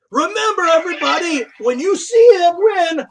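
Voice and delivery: rally the team in a high voice; high character voice. Acted. High-voiced figure addressing team.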